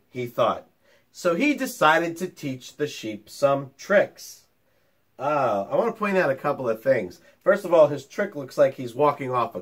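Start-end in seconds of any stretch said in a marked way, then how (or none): none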